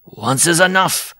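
high, slow, grim pronouncement. A narrator's reading. Dwarf rejects wife's oath, slow high grim voice. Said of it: "the dwarf said grimly".

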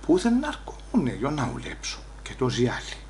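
very soft aside to yourself; hushed private grumble; inner muttering. Acted. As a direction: drop right down, as if thinking aloud. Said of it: "λέει από μέσα μου".

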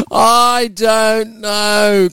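slow, high, drawn-out doubt. Real, not acted. Laughing, unsure about the request.

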